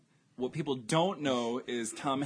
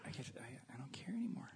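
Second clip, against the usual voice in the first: whisper; 17 dB quieter, faster than usual. Real, not acted. Tired of arguing late at night.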